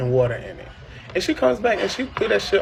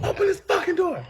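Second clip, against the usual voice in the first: high, furious shout through the door; mother yelling. Retold or imitated. Mother yelling at daughter through the locked door, high and furious. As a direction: bang on the door, demand it open.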